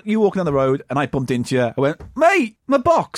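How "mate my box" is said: mock con man. Acted con quote.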